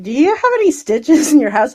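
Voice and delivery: high, loud mock plea; mock asking for stitches. Asking a doctor friend for stitches, mock pleading.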